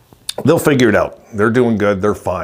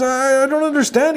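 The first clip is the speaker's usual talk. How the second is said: very high, bewildered mock whine; freaking-out buddy. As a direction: mimic a friend who can't believe it, voice pitched way up. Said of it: "he's freaking out".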